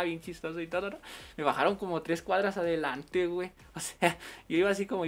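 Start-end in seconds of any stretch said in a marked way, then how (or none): none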